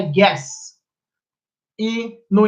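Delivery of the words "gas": "gas" said with an American accent. Shown as a demonstration. Teacher giving American word in American accent. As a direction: model the American "gas" for the class. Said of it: "no inglês americano, é".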